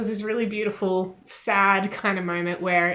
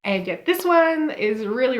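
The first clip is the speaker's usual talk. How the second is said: ordinary talk, nothing marked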